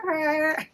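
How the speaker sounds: high mock wail of panic; high-pitched comic "aah"; imitated frantic cry. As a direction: imitate someone running around flustered. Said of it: "running around, like, going".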